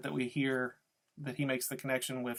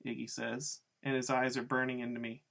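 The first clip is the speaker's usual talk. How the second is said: usual speaking voice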